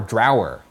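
"drower" with the vowel of "how"; alternate pronunciation. Shown as a demonstration. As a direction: say "drower" to rhyme with "flower". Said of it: "three pronunciations of drawer in question marks".

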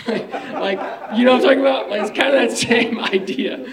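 laughing loudly through the words; amused laughing aside. Real, not acted. Laughing at own comparison.

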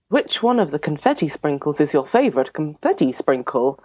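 normal talking voice

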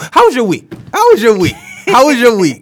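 high, loud, repeated call; hyped-up host. Acted. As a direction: pump the question up like a host.

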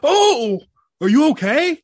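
high, loud worried call; father hearing a bump calls out to the kids, alarmed; mock alarmed call. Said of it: "if I hear, like, a bump".

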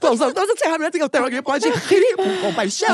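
high, mock-desperate plea to a silent crowd; desperate pleading. Comic whose jokes are not working begs the audience, desperate. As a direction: beg for pity laughs, comically frantic. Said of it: "tem momentos que é desesperadores".

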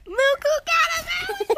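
very high, loud delighted repeat; excited squeal. Acted. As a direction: squeal the punchline back. Repeats the child's punchline.

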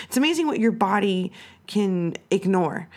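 ordinary talk, nothing marked

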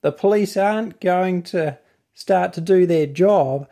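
usual speaking voice

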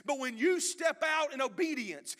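loud, rising preacher's cry; preaching at full force. Acted. Preacher urging the church to obey, loud and rising. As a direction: preach it hard to the crowd.

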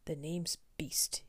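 ordinary delivery, nothing marked

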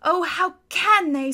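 tearful. Acted. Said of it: "tears streaming down her cheeks".

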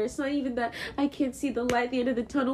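usual speaking voice

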